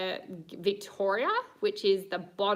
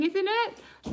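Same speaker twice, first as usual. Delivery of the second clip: ordinary delivery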